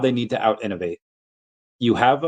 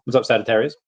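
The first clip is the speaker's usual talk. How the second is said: ordinary talk, nothing marked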